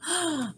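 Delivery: quiet amazed gasp; a hushed gasp of wonder; soft gasp. Acted. Amazed gasp reading story.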